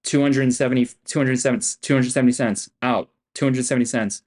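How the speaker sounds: ordinary talk, nothing marked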